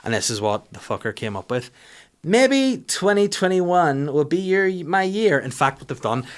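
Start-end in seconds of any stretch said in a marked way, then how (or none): 2.2-5.4 high, slow, hammed-up inspirational voice; dreamy mock-uplifting slogan voice, drawn out; mock motivational read. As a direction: read it like a cheesy motivational poster. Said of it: "these motivational guys".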